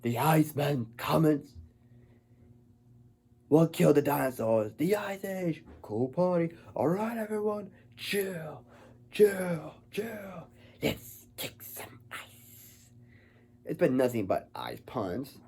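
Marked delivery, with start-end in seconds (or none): 0.0-10.5 lightly voiced pun-spouting villain, pitch higher; a hint of Schwarzenegger's Mr. Freeze; mild mock villain one-liners. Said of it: "Schwarzenegger had to say these ridiculous puns". 10.8-12.9 slow whispered villain one-liner; mock menacing whisper; whispered pun. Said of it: "Schwarzenegger had to say these ridiculous puns".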